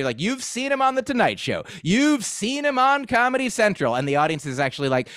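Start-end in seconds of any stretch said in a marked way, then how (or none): 0.2-3.9 comedy show announcer. Retold or imitated. Host introduces the comedian, high announcer voice. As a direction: sell the comic like an emcee. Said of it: "the way that you get introduced sometimes in comedy".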